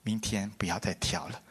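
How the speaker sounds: whispered, tired plea; father whispering. Retold or imitated. Worn-out father whispers to his son not to dance tomorrow. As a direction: breathe it like a worn-out old man. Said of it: "然后到晚上又说".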